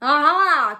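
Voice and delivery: loud triumphant aha; cry. A narrator's reading. Lion recognizes bush rat's voice, loud cry. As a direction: bellow it out, caught you. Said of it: "cried the lion".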